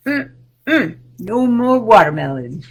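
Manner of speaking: normal talking voice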